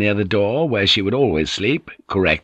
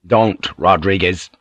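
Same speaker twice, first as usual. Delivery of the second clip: ordinary delivery, nothing marked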